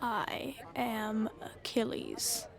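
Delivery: hushed, breathy whisper of a name. Acted. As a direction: whisper it close, revealing yourself. Achilles reveals himself, hushed.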